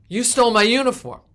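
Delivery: loud, high, furious accusation; angry accusation. Retold or imitated. Angry neighbor accusing her at the wake, shouting. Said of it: "I was horrified".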